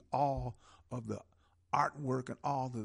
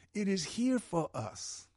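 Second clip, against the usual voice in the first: ordinary talk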